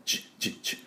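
quiet sizzle-like ch clicks; hissing tch sounds; voiceless sizzle ch. Shown as a demonstration. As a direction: spit out short voiceless ch sounds like grill sizzle. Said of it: "この水分が吹っ飛ぶ音です".

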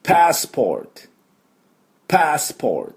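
crisp teacher's demo of "パスポート" in English, pitched high; English pronunciation. Shown as a demonstration. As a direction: model "パスポート" as English passport, open "a", r-coloured "or". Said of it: "私の後について発音です".

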